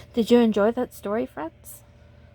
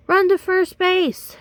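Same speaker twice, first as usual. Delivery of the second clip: high, slow, loud yell; drawn-out shouted instruction; 10 semitones higher, 7 dB louder, slower than usual. Acted. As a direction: shout the direction across the field. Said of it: "yelled the boys".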